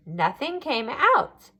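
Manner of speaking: plain reading voice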